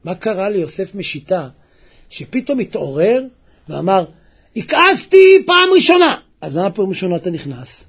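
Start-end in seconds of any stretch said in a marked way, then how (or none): 4.6-6.2 high, loud cry of realization; sudden raised-voice exclamation; acted outcry. Acts the man's sudden awakening.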